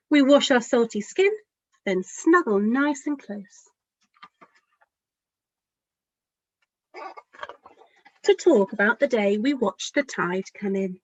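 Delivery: gentle picture-book storytelling; lively, caring storybook narration; reading to children. A narrator's reading. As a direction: read it as if to a room of small children. Author reads her picture book aloud.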